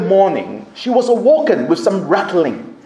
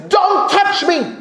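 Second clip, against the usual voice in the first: screamed defiance; 10 semitones higher than usual. Retold or imitated. Frightened missionary facing armed intruders, mock screaming. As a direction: imitate a woman screaming off her attackers. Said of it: "she screamed at the man".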